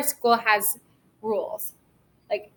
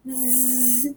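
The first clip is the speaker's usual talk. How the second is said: soft "zzz" like a bumblebee; bumblebee buzz; 7 dB quieter than usual. Shown as a demonstration. Teacher buzzing like a bumblebee for pupils, soft. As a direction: buzz it quietly. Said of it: "be like a bumblebee".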